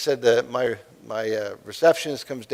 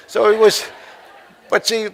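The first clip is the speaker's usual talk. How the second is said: ordinary talk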